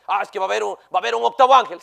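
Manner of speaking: lightly voiced naive believer; a hint of mocking gullibility; mocking the gullible. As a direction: mimic the false teaching with a sneer. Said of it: "Entonces viene alguien y le dice".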